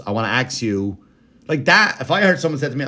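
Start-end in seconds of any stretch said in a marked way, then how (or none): 0.0-1.0 AX with a ks ending, mimicking him; ax for ask. Host mimics him before his pause, saying AX for ask. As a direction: swap the sounds of ask to "ax". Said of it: "he'll do that and he'll pause".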